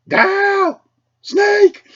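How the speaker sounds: very high, loud shriek; grandma shrieking for her husband, very high and loud; shrill yell. Retold or imitated. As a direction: scream his name in alarm. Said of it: "my grandmother goes".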